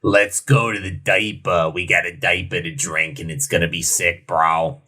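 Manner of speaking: bro voice. Acted. Hyping up the next beer.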